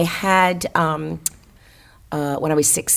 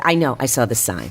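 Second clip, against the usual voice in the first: usual speaking voice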